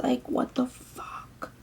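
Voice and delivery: muttered disbelief at the school; hushed disbelief. Real, not acted. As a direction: let it out under your breath, slowly. Venting over suspension.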